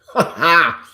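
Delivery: loud burst of laughter; big real laugh. Real, not acted. Laughs at early hour.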